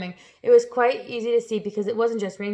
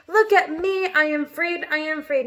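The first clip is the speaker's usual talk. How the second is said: exulting; 7 semitones higher, slower than usual. Acted. Centipede exulting.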